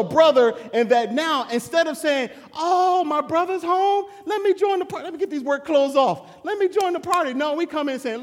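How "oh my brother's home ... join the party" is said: higher and louder, eager celebration; joyful welcome. Retold or imitated. The brother we should be, welcoming him home, high and loud. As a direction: throw the door open for the returning brother.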